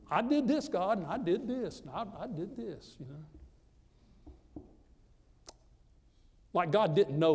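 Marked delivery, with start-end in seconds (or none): 0.1-3.0 mocking himself. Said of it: "had a whole lot of I's in it".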